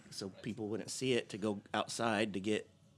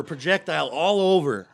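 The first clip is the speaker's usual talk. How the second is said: louder, emphatic retelling; loud exclaim; 4 semitones higher, 10 dB louder than usual. Retold or imitated. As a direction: hit it hard and loud. Retelling the vomiting.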